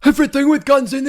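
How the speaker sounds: loud, high-pitched impression shout; Josh Brolin. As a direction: yell it in a put-on character voice. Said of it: "I love doing his voice".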